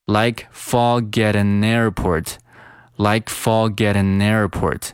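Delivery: "like forget an airport" as one slow, joined flow; fully linked phrase. Shown as a demonstration. Teacher giving the full linked sentence, slow and joined. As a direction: chain every word of "like forget an airport" together. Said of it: "这句话就读成了".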